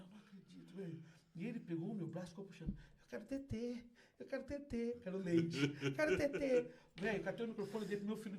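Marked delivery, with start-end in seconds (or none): 0.0-1.0 very soft, under the breath; father muttering aside; hushed disbelief. Retold or imitated. 2.7-6.7 slow, lightly voiced child's whine; whining child. Son whining for milk.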